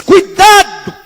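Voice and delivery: high, sudden preacher's cry; high-pitched pulpit shout; shouted warning. Retold or imitated. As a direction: fire out a dramatic retold warning. Retold stern warning to a critic.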